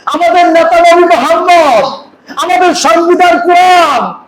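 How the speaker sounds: high, rising preacher's chant; chanting slogans at full force; fiery preaching. Acted.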